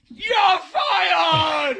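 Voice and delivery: very high, loud shout; Dolly firing the teen; furious mock yell. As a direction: scream the firing. Boss firing him.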